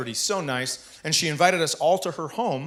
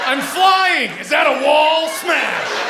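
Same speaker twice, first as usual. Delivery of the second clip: high, loud, whooping flight then crash; a clueless hero mid-flight; hammed-up hero yelling; excited superhero; 12 semitones higher, 8 dB louder, much slower than usual.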